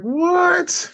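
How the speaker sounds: very high wailing what; a sobbing, disbelieving cry. Retold or imitated. Patient told he goes to another hospital, high crying wail. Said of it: "And I started crying".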